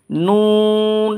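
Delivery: নুন held long from the tongue tip; drawn-out Nun. Shown as a demonstration. Teacher demonstrating a long Nun. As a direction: stretch নুন for three alif lengths. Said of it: "তিন আলিফ টানবেন".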